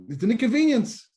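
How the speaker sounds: high, loud, mock-annoyed; punchline. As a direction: deliver the punchline with indignation. Punchline of joke.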